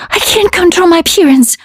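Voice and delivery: high, fast frightened shout; panicked shout. A narrator's reading. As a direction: blurt it out in panic. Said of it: "one of them shouted".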